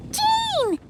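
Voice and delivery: a bright greeting call. Acted. Paimon spotting Jean, calling out her name. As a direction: call her name across the room, high.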